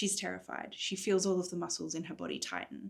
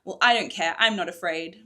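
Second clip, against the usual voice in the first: louder, forced defiance; false bravado; 9 dB louder than usual. Acted. Morrigan bluffing to Cadence, mock bold. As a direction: pretend not to be afraid. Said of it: "Morrigan lied".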